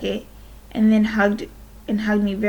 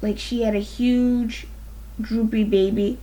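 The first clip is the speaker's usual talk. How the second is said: ordinary delivery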